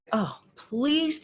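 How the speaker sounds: ordinary talk, nothing marked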